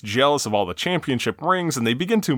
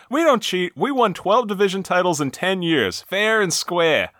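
Boston fan; 6 semitones higher than usual. Acted. Host quotes Boston fan answering Lenny's shouting, higher mock-defiant voice. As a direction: play an indignant fan defending the team. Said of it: "one Boston fan replies".

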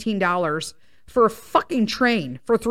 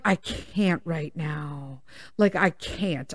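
softer, lower, slow worn-out voice; weary defeated plea; 7 semitones lower, 8 dB quieter, slower than usual. Retold or imitated. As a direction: sound completely drained. Exhausted plea to agent.

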